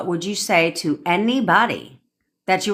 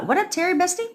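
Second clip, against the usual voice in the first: playful greeting; faster than usual. Real, not acted. Greeting viewer in high voice.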